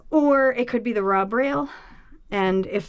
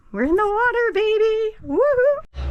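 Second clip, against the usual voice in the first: much higher and louder, whooping with joy; excited cheer; 14 semitones higher, 4 dB louder than usual. Real, not acted. Boat owner tells viewers they're launched, excited whooping cheer. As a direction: cheer like the boat just hit the water.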